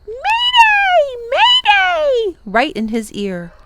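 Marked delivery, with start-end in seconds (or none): 0.1-2.4 shrill bird scream, very high and loud. Acted. The wiffle bird screams an alarm in Tom's ear, panicked. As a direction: shriek an alarm right in his ear. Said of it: "she screamed".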